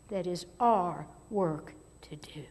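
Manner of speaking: hushed emphatic whisper. Real, not acted. Preacher whispers the key point to the congregation. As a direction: drop to a whisper to drive it home.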